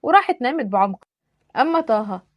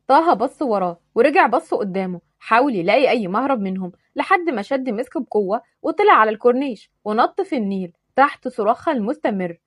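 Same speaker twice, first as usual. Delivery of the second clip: plain reading voice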